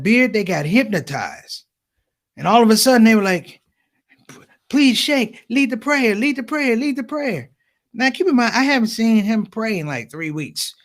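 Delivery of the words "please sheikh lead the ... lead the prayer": high, insistent mock pleading; pleading crowd. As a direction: beg over and over like a hypnotized crowd. Crowd begs him to lead prayer.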